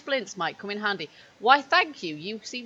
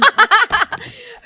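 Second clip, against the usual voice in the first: loud laughter; 13 semitones higher, 11 dB louder than usual. Real, not acted. Laughs at blocked door.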